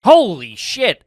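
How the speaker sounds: slow, drawn-out amazed exclamation; surprised. Real, not acted. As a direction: stretch it out in disbelief. Reacts to nine weeks fact.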